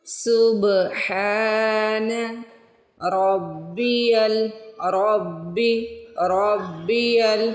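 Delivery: रब्बियल with a doubled bb; रब्बियल, bb held firmly; joined ra and ba. Shown as a demonstration. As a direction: join रा to बा in रब्बियल. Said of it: "पहले रा को बा से मिलाना है".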